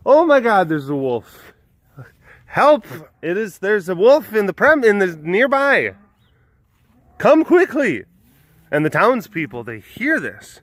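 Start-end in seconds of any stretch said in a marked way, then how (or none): 0.0-8.0 high-pitched mock cry for help; hammed-up boy crying wolf; mock yelling. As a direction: call out a false alarm to the village. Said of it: "he starts yelling".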